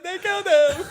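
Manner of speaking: much high, loud outraged cry; mock wail. Actor mourns the character in a high outraged cry. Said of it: "It's like".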